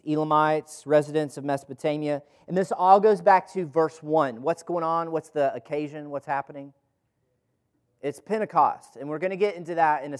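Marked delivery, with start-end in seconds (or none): none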